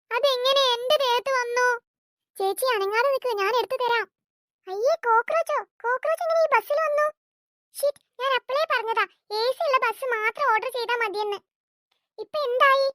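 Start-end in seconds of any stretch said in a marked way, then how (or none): 4.7-8.0 higher, startled disgust at a cockroach; shocked passenger spotting a cockroach on the bus; disgusted surprise. Acted. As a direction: recoil in shock.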